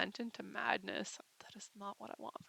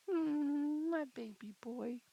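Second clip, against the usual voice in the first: cooing; 5 semitones higher, slower than usual. Real, not acted. Cooing to her dog Toby.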